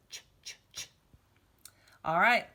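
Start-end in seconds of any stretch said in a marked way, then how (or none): 0.1-0.9 Ch, ch, ch as soft breathy unvoiced puffs; ch sound. Shown as a demonstration. Teacher demonstrating the ch sound, softly. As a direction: hiss out the ch sound quietly. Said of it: "that also has that C H in it".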